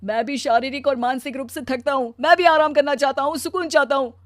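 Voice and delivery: anguished protest, swelling then sinking; tearful outburst. Acted. Husband telling wife he is exhausted, tearful and hurt. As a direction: protest through tears, ending in a sob.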